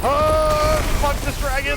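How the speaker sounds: yelled high and loud at the dragon; angry outburst. Real, not acted. Gamer yelling at a dragon after the wrong action, furious shout. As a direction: blow up at the dragon in real frustration.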